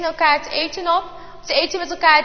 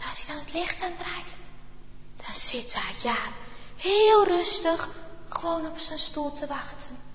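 hushed suspense; 13 dB quieter than usual. A narrator's reading. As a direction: draw the children in, hush. Suspenseful storytelling reveal. (zacht en spannend verteld aan de kinderen)

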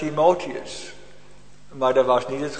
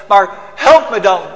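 loud, high cry for help; desperate plea; 7 semitones higher, 14 dB louder than usual. Acted. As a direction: beg like someone who is lost. (luide wanhopige smeekbede)

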